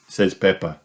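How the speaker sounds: ordinary delivery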